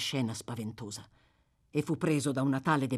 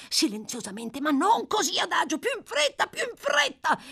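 rising, much higher frantic shout; 14 semitones higher, 4 dB louder than usual. A narrator's reading. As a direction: yell it louder and louder.

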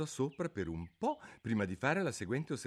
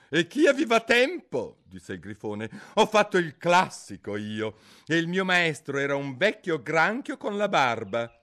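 impatient griffin, higher and louder; gruff griffin; 7 semitones higher, 9 dB louder than usual. Acted. Griffin impatiently urging the turtle to get on with it. Griffin recalls his master.

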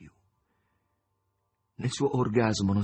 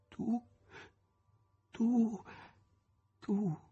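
high, dazed repetition; repeating senselessly; 13 semitones higher, 5 dB quieter, much slower than usual. A narrator's reading. Margherita to her returned lover, high and dazed. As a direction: say it over and over, lost. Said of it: "la ripeteva come insensata".

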